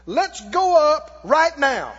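loud and slow, deliberate; loud bold rally. Acted. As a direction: make them believe it, slowly and loud. Caleb rallies the people.

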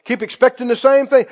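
ordinary talk, nothing marked

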